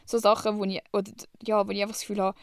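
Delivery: normal talking voice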